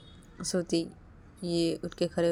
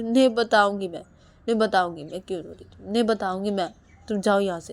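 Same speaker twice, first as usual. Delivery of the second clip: louder insistent no; stubborn refusal; 6 dB louder than usual. Acted. Wife refusing to tell her husband, stubborn and loud. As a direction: dig in and refuse.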